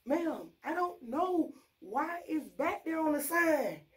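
high, slow customer-service voice; exaggerated patience with a customer; put-on nice. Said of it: "talking to you nice".

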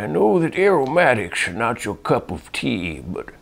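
lightly voiced old man. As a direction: read it as an elderly man. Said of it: "He's requesting an old man voice".

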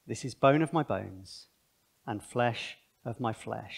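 soft, slow, tender reading of "bone of my bones"; solemn scripture reading. Acted. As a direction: linger on each word with awe. Reading Adam's words solemnly.

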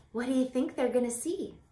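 plain reading voice

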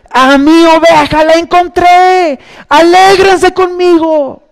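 high, loud, slow preacher at his climax; preaching at full force. Acted. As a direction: lift the congregation with joy. Clímax de la predicación. (predicación a todo pulmón, alta y lenta)

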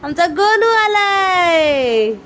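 high, very slow, drawn-out delight; excited announcement. Real, not acted. Announcing baby's arrival. (आनंदाने लांब ओढलेली घोषणा)

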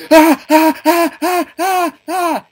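short, very loud high screams; piercingly high, repeated yelps; panicked screams. Real, not acted. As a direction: scream repeatedly in sudden fright. Second jump scare.

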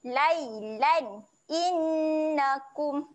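slow clear chanted recitation; recited; Quran recitation. Shown as a demonstration. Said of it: "baca dengan jelas".

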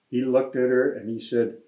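ordinary delivery, nothing marked

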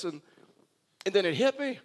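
ordinary talk, nothing marked

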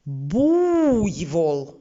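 буйвол, у drawn out; stressed U. Shown as a demonstration. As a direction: lean on the у in буйвол. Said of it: "вимовимо слово так, щоб чітко чути".

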